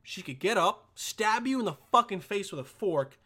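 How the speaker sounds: ordinary delivery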